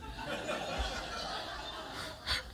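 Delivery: wheezing voiceless laugh; a hushed wheeze of laughter; breathless silent laugh. Real, not acted. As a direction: laugh without voice. Laugh about mail lady.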